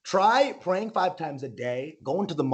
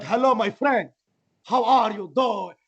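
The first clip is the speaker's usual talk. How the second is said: higher, drawn-out put-on Iranian accent; newly Iranian friend with a fake accent; mock Iranian accent; 6 semitones higher, slower than usual. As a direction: ham up an Iranian accent, stretching the words.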